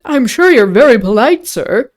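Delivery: tickled tavern keeper, English dialect; amused innkeeper. A narrator's reading. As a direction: thank a guest with hammed-up country warmth. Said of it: "abundance of his laughter".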